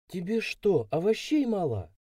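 put-on high-pitched, discontented girl's question; displeased. A narrator's reading. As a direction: ask with mild annoyance. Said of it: "спросила она недовольно".

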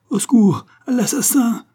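high, loud panicked scream; terrified scream for help. Acted. Woman about to be hit screams for help in terror. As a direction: shriek for help as if about to be struck. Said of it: "cria la femme".

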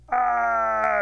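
long, high, loud scream; falling scream. Acted. Turtle thrown over the cliff, screaming.

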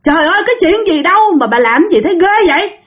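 high, heated yelling back; angry retort. Retold or imitated. Younger sister yelling back at her older sister. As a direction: hit back in a rage. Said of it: "Bạn tức lắm, bạn nóng lên".

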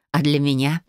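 ordinary delivery

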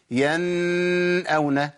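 ينأون with the n held far too long; wrongly prolonged, steady nun in ينأون; overlong nun. Shown as a demonstration. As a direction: drag out the n of ينأون before the hamza. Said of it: "لأن زودت الزمن زيادة".

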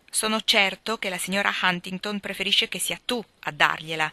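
plain reading voice

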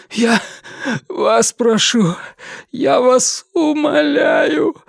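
high, slow sobbing plea; crying plea. Acted. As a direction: beg through tears, slowly. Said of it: "заплакал Вивьен". (плачущая мольба)

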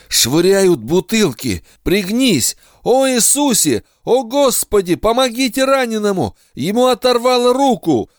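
frantic high yelling; panicked battlefield shout. Acted. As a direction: scream orders in terror. Flashback under grenade fire.

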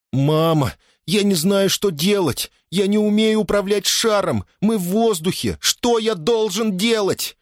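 put-on high, frightened child's cry; rising panic, pitched high; panicked boy's plea. A narrator's reading. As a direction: plead helplessly for instructions. Lost boy pleads with his mother.

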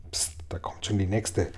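low, hushed whisper; whispered warning. A narrator's reading. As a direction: hush the others in a whisper. Warning the others a gull approaches. (geflüsterte Warnung)

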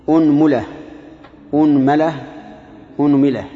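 أنملة said unmula, unmala, unmila, hamza with u; أنملة with initial u, three vowel variants; damma on hamza. Shown as a demonstration. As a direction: run أنملة through u, a, i on the mim. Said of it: "خذوا ضم الهمزة وتثليث الميم".

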